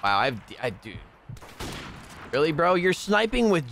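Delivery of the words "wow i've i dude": ordinary talk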